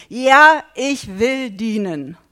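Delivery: loud, slow cry of commitment; preacher voices the believer's loud yes; fervent vow. Acted. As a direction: call it out as a vow.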